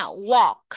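"Walk" said loud with a round open O and the l silent; pronunciation model. Shown as a demonstration. Teacher modelling "Walk" loudly for the class. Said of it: "Các bạn phát âm theo cô nha".

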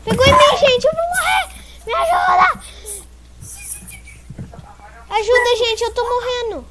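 high panicked screaming for help; panicking gamer. Real, not acted. Character dying in game.

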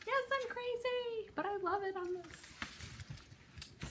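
high, slow, drawn-out singsong; playful singsong confession. Real, not acted. Singsong admission of being crazy.

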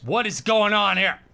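loud, high, slow outburst; exasperated yell. Real, not acted. Gamer baffled by the game scene, exasperated yell. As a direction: holler it in disbelief, stretching the words.

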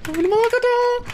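high frantic yelling; scared horror game streamer. Real, not acted. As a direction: scream for the door to open. Yelling to open door while chased.

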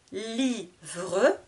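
"Livre" as Li... vre, final e sounded; split into syllables. Shown as a demonstration. Teacher showing two syllables, "Livre" split Li... vre. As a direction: cut "Livre" in two, voicing the last e. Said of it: "Deux syllabes".

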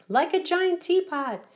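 high, loud sailor's shout. A narrator's reading. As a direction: call it out across the harbor. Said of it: "shouted the sailors".

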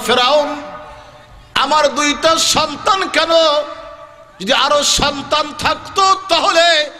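high, ringing sermon chant; impassioned preaching. Acted. Maidservant defies Pharaoh before her children die, impassioned high preaching.

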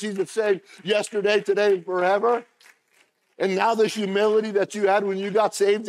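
loud, high, rousing sermon voice; preaching at full force. Acted. Preacher stirring the congregation, loud and high. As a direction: preach it out with rising fire.

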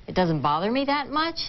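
"much" rising like a question; uptalk. Shown as a demonstration. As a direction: lift the end of "much" upward. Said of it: "Every sentence ends in an up".

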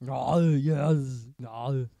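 loud, hammed-up Arnie voice; Arnie impression. Showing co-host the army voice for icy puns, loud Arnie impression. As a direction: boom it out as Arnie. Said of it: "That's my army voice".

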